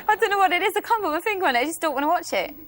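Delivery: ordinary talk, nothing marked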